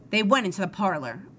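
plain reading voice

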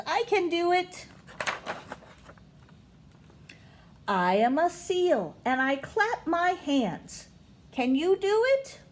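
lively read-aloud with high, rising questions; storybook voice, questions lifted up high; reading to children. Acted. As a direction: read it like a picture book for little ones. Reading picture book to kids.

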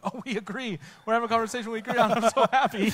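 high, fast gushing joy with a laugh; overjoyed, giggling agreement; gushing delight. Retold or imitated. Gushing to skeptics who always disagree. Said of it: "And I'm like".

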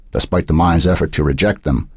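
usual speaking voice

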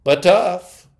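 excited. Acted. God defending his creation to the angel, excited. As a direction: proudly insist on it. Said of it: "said God excitedly".

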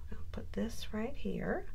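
breathy whisper while placing a piece; soft unvoiced aside over the crafting table. Real, not acted. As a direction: whisper it to yourself as you work.

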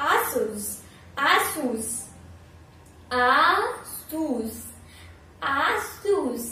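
Asus said slowly and clearly as "aa-sus", stretched with a falling end; correct pronunciation. Shown as a demonstration. Teacher modelling the brand name Asus to viewers, slow and clear. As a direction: spell out Asus for the class. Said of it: "ये वाला brand का pronunciation".

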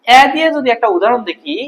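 ordinary talk

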